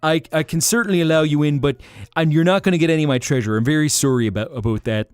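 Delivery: hammed-up apologetic Canadian dragon turning a visitor away; dreadful mixed Canadian accents. Dragon politely refusing a visitor, in a hammed-up Canadian accent. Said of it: "my dreadful amalgamation of Canadian accents".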